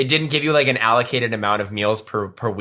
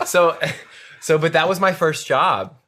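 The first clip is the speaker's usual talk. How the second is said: ordinary delivery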